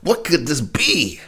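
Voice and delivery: high, slow mock-curious voice, breaking into a laugh; nosy curious driver. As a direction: peer over and wonder, hammed-up. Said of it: "you're like".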